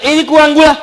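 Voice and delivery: husband griping at his wife over sugar; grumpy husband. Acted. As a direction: complain about the coffee like a grumpy husband. Husband complaining about coffee.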